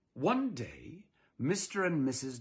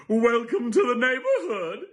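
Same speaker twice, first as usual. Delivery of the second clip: much higher, louder bubbly welcome; cheery neighbor lady; 10 semitones higher, 10 dB louder than usual. Acted. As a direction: greet the new neighbors with big sunny cheer.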